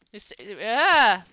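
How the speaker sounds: loud, drawn-out cry of alarm; startled yell. Real, not acted. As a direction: yelp as you get hit. Gets hit by a mob.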